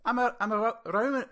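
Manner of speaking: high, put-on Scouse voice; Scouse accent. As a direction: try a Scouse accent, failing. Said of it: "I can't even fucking do it".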